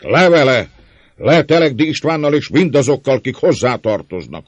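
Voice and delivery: high, loud angry chant of condemnation; mob roar. A narrator's reading. Twenty rioters against Telegdi's people, roaring. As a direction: roar it like a whole crowd. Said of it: "ordított húsz hang".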